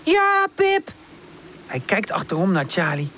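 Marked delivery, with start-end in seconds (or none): 0.0-0.9 high, drawn-out answering call; high-pitched plucky shout, mildly loud; brave call. A narrator's reading. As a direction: call back bravely to someone far off. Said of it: "Woezel roept dapper".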